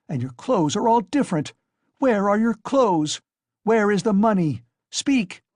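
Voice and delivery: high, loud, furious accusations; frenzied shouting. A narrator's reading. Wife screaming at her husband over the money, frenzied. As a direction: berate the drunk husband in a frenzy. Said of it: "she cried out in a frenzy".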